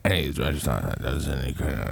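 low, mumbled ramble; rambling about nothing. Retold or imitated. Comic mimics the guy who talked about nothing, low rambling. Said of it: "who walked up and just talked about nothing".

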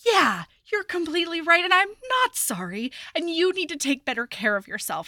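high, indignant mock telling-off; emphatic acted-out rebuke; retold scolding. Retold scolding of injured man.

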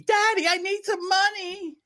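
put-on high whiny rich-kid voice; whiny spoiled kid. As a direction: beg Daddy like a spoiled brat. Mocking a spoiled rich son.

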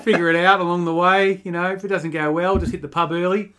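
hammed-up Australian accent for a Kenyan runner; cheeky Australian accent, pub-mate style; bad Australian Kipchoge. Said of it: "a chilling impersonation of Kipchoge".

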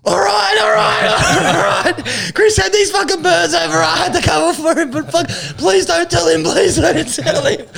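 put-on high, laughing, whiny kid voice confessing everything; caving kid brother. Kid brother finally confesses to his dad, high and whiny.

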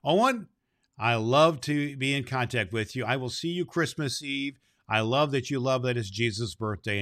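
usual speaking voice

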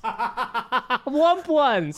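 high, laughing repeat of a silly word. Real, not acted. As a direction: crack up while saying it.

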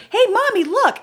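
high-pitched mock toddler calling mommy; little boy. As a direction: call out like a three-year-old. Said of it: "he's three years old".

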